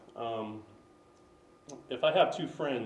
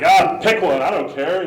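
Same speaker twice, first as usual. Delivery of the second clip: loud, higher-pitched mock impatience; acted exasperation; 5 semitones higher, 15 dB louder than usual. Models giving up after going back and forth, loud mock exasperation. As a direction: throw it out as if fed up. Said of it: "they'd just be like".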